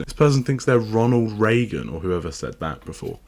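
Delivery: usual speaking voice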